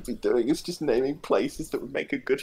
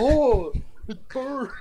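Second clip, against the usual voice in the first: a lighter, higher copy of the moose yell; mock moose bellow; 8 semitones higher, 5 dB louder, much slower than usual. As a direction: echo the moose cry, half-hearted. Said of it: "Sounds like a moose".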